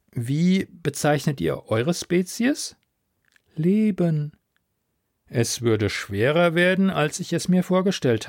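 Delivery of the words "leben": slow, higher, otherworldly voice; drawn-out alien calm; alien sea voice. Acted. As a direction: speak as a vast ocean mind, unhurried. Alien sea mind speaks.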